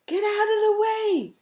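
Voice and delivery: high, loud sheep's yell. A narrator's reading. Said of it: "he yells with a beep".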